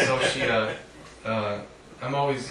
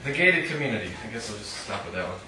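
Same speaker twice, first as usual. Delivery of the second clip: ordinary talk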